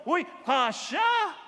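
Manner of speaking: slow, high, loud cry of joy; delighted shout. Acted. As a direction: shout it out as the shirt fits. Acts receiver trying on clothes. (masayang sigaw na mabagal)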